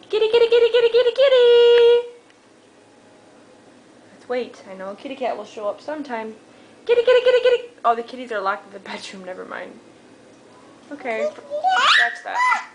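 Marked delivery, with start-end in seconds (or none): 0.1-2.1 calling the cat. Real, not acted. Calling the cats out. 6.9-7.7 calling the cat. Real, not acted. Calling the cats again. 10.9-12.7 laughing. Real, not acted. Laughs at own mistake.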